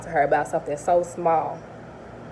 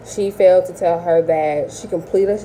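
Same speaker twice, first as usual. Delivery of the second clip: ordinary talk, nothing marked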